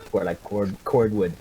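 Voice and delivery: normal talking voice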